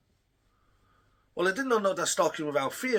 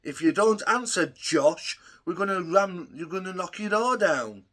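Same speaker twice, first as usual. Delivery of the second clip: higher, louder mock police calling; 4 semitones higher, 6 dB louder than usual. Ranting about police at his door, mocking their calls. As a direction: parrot the officers' shouting. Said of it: "ram raiding on my door saying".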